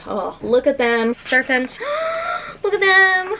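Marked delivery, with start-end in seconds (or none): 1.8-2.6 sharp high gasp of delight. Real, not acted. Unboxing her favourite books, a high delighted gasp. Unboxing a beloved book set. 2.6-3.4 high, loud squealing delight; excited squeal. Real, not acted. Showing viewers the books, a high excited squeal. Gushing over the book set.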